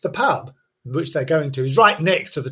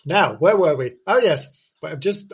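ordinary talk

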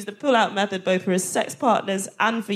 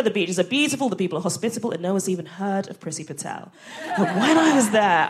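ordinary talk

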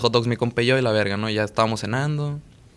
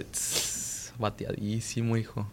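hushed aside; 11 dB quieter, much slower than usual. Retold or imitated. His offhand reply to the girl.

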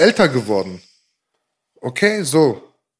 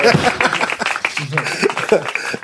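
breathy laughter dying away; real trailing laugh; 2 semitones lower, 3 dB quieter than usual. Real, not acted. Host still amused by the guest's quote, trailing laugh. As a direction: keep laughing as it tails off.

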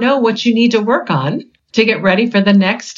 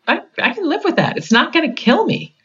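relieved; 8 semitones higher than usual. Retold or imitated. Relieved self-talk quoted.

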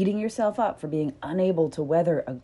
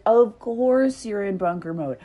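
slow, louder, kindly insistence; a touch of warm reassurance, slowed; understated reassuring; 5 semitones higher, 6 dB louder, much slower than usual. Pretend. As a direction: reassure them it makes sense. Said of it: "would I be like".

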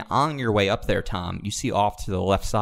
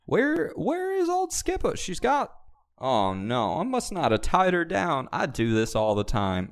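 put-on southern accent; 9 semitones higher than usual. GM playing lost-horse Paul Revere, hammy southern accent. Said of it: "What accent is this?".

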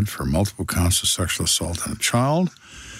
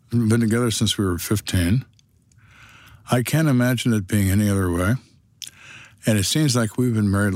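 ordinary delivery

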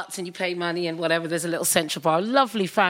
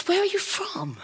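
put-on curious girl; 12 semitones higher, 4 dB quieter than usual. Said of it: "she said".

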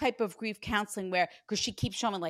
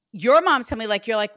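usual speaking voice

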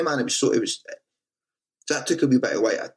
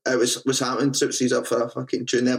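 ordinary delivery

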